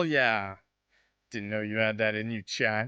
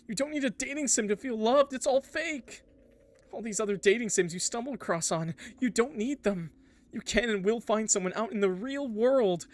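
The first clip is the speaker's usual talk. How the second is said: much higher pleading voice, urgent; desperate trapped character; 10 semitones higher, 3 dB louder than usual. Acted. Trapped character begging the player to log off, pleading high. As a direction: plead to escape before deletion.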